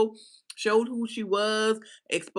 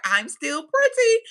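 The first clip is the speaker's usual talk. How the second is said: higher, louder, preening "I'm still pretty"; 1 mock proud Kim Bella; 9 semitones higher, 6 dB louder than usual. Imitating Kim Bella bragging after the brawl, preening. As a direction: toss it off like a vain reality star. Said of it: "Kim Bella was like".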